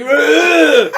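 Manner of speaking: high, loud pained grunt; mock grunt. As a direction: grunt like taking a punch. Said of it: "He's just like".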